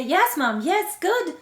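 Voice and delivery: high, loud, delighted little voice; imagined happy baby. Acted. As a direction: cheer Mom on as a happy baby. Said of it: "as if to say".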